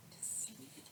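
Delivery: trailing off to a whisper; sleepy whisper. Acted. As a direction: let it fade into a whisper. Fading bedtime line.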